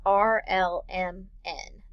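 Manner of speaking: slow, letter by letter: "R L M N"; spelled out slowly. Shown as a demonstration. Teacher spelling the mnemonic letters for students, slow and clear. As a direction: space each letter out deliberately. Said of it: "the four letters that you need to remember".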